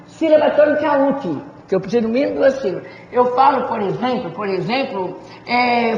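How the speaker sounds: normal talking voice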